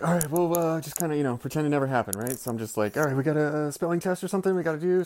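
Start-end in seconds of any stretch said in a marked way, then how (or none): 0.0-2.4 higher, hurried mock composure; slightly panicked fake casualness; flustered cover-up. Retold cover-up to the class. 3.0-5.0 higher, quick, mock-casual teacher voice; acted nervous improvising, pitched up; flustered diversion. Retold flustered teacher changing subject.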